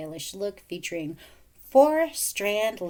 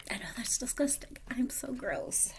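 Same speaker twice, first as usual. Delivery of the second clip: whisper; 3 semitones higher, 6 dB quieter than usual. Real, not acted. Whispered self-disgust.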